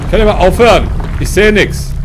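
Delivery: frustrated shout at the screen; annoyed yell. Real, not acted. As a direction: yell at the game to stop. Shaking screen, yelling at game.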